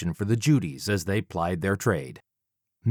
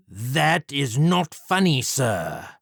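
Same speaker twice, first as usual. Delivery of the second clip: higher, slow, affronted villain; offended Jack; 5 semitones higher, much slower than usual. Acted. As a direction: bristle at the insult, slowly. Villain Jack voiced in story.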